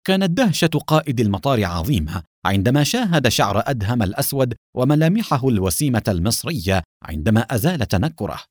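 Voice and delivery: ordinary delivery, nothing marked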